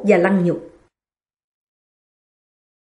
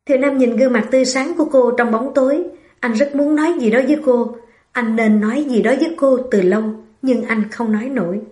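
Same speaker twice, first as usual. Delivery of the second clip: ordinary delivery, nothing marked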